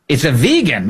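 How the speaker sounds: vegan said as VEE-gun; correct vegan. Shown as a demonstration. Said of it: "how to pronounce that thing".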